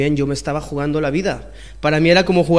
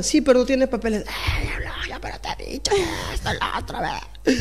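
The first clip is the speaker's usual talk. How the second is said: annoyed owner; 6 semitones higher, 6 dB quieter than usual. Retold or imitated.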